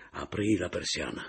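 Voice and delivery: ordinary delivery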